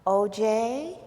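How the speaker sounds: soft, gentle calling of a name; softly coaxing, unhurried; quiet, soothing. Retold or imitated. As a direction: imitate a friend calming someone down. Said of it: "in a very quiet and soothing voice".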